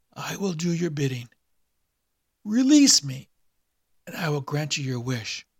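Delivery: a corpse croaking up from the abyss; the awakened dead man's dry rasp; dry raspy dead man. A narrator's reading.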